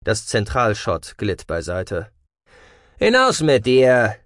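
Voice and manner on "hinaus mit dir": loud, drawn-out order; called out. A narrator's reading. Cassian pushes Marv toward the exit, calling out. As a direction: shout it slowly and forcefully. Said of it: "rief er".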